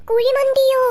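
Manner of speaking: high, loud shocked question; over-the-top astonishment. Acted. Hearing a sibling suggest kuzhimanthi, exaggerated shocked yell. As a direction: blurt it out in flustered amazement. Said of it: "ഇത്രയ്ക്കങ്ങ് expression ഇട്ട്".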